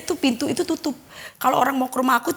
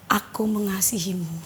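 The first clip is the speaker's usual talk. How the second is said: soft, lower, tender voice; suara lembut banget; 4 semitones lower, 6 dB quieter than usual. Retold or imitated. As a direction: speak it low and softly, like a gentle caress. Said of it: "Suaranya lembut banget gitu". (lembut dan pelan)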